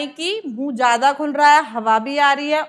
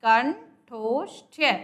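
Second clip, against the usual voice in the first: कंठोष्ठ्य said very slowly, syllable by syllable; slow dictation; much slower than usual. Shown as a demonstration. Teacher naming the place of articulation, slow. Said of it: "उच्चारण स्थान आ जाता है".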